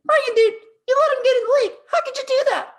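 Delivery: high-pitched, loud scolding of a teammate; hammed-up, squealing reproach; mock outraged yelling. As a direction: imitate an exasperated kid yelling. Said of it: "no trouble yelling at me".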